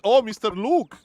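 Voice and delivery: excited greeting. Real, not acted. Greeting a chatter by name, with sudden excitement. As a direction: greet him with sudden delight.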